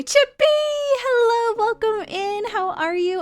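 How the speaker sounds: excited. Real, not acted. Greeting viewer arriving.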